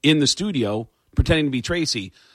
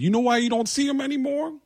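high put-on voice, slowing down, breaking into a laugh; deadpan fake confession in a high voice; Tracy Morgan impression; 9 semitones higher than usual. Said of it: "as Tracy Morgan".

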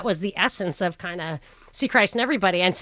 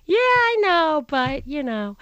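mother's shrug; 5 semitones higher, slower than usual. Retold or imitated. Mother's dismissive reply retold.

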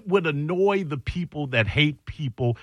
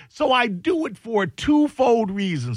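ordinary talk, nothing marked

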